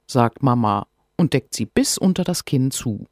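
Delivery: plain reading voice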